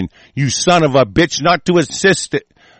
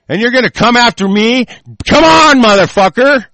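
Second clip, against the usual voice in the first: much louder and higher, screaming the dare; furious challenge; 8 semitones higher, 6 dB louder than usual. Real, not acted. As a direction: scream come on at them. Challenging enemies to come.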